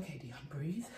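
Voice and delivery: soft, low calming hush; soft self-soothing. Retold or imitated. Telling herself to breathe in a soft low hush. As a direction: talk yourself down gently. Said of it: "I was like".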